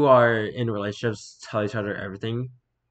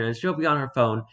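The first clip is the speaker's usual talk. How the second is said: ordinary talk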